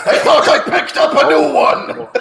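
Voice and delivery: high, loud pirate with a laugh; confused pirate. Acted. Pirate who misheard polo, loud and high. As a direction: play the muddled pirate. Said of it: "a pirate coming in who misunderstood".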